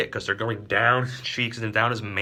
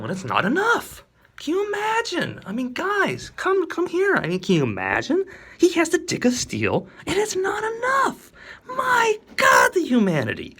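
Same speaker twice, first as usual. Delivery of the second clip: much higher and louder, hammed-up disbelief; mock outrage; 6 dB louder than usual. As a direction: rant at the crowd in fake horror.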